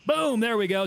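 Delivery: high, punchy burst of excitement; triumphant exclamation. Real, not acted. Gamer who just hit the enemy, high excited cry. Lands a shot in game.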